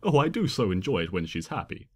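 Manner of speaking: ordinary delivery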